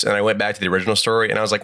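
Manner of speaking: ordinary delivery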